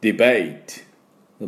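ディベー held and stressed, then a separate ト; ディベート broken before the final ト; stress on bay. Shown as a demonstration. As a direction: lean into ベー of ディベート. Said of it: "ベイのところにアクセントがあります".

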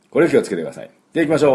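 ordinary talk

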